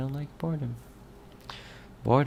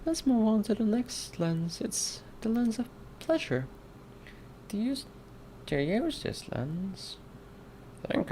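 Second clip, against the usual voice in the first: put-on high-pitched little child voice; put-on tiny-kid voice, mostly soft; four-year-old kid; 11 semitones higher, 7 dB quieter than usual. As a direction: squeak it out like a small kid. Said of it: "four-year-old kid accent".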